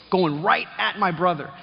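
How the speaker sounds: ordinary delivery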